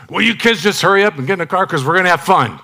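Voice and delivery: high, rushed mock dad outburst; losing it. Dad hurrying kids into the car for vacation, comically losing it. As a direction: imitate an exasperated father hurrying the kids along. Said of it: "when I would lose it with my kids".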